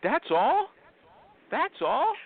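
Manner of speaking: high-pitched surprised question, rising at the end; taken aback, voice jumping up; incredulous. Real, not acted. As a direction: ask it in disbelief, pitched well up. Host taken aback by low percentage.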